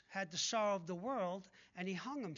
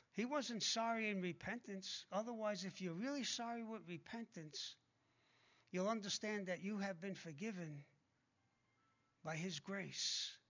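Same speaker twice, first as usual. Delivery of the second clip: ordinary talk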